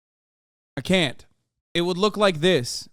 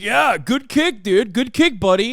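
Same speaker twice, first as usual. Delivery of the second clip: mock taunting fighter; 8 semitones higher, 5 dB louder than usual. Streamer imitating a fighter taunting his opponent, hammed up. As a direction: ham up a smug fighter mocking his opponent. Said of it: "He's like".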